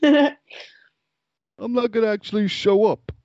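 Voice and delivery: high, drawn-out Italian-American wiseguy; Italian mobster. Acted. Italian guy to hospital interviewer, brazen wiseguy voice. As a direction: announce it slowly in a wiseguy voice. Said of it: "his job interview went something like this".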